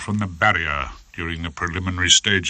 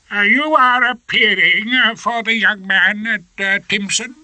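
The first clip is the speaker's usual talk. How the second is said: a pompous high-court judge, much higher; fussy old judge; 13 semitones higher, 4 dB louder than usual. Acted.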